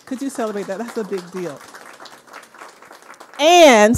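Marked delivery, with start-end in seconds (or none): 3.4-4.0 loud, higher, drawn-out "And"; emphatic call. Real, not acted. Host prompting the audience to say and, emphatic call. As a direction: belt out a big "And" to the crowd. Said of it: "say and".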